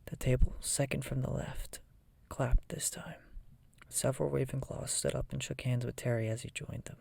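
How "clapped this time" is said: plain reading voice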